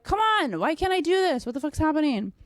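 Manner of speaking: pleading with herself, half-hearted; exasperated. Retold or imitated. Stuck in the drill, mock exasperation at herself. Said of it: "you're like".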